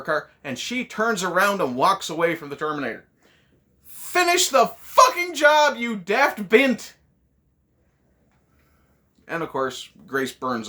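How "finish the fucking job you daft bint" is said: slow, high, loud yell at the screen; furious yell. Acted. Viewer screaming at Sarah on screen, furious. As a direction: bellow it at her like a fed-up viewer.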